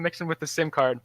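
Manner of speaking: ordinary talk, nothing marked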